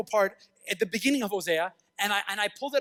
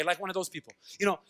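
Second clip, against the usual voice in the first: ordinary delivery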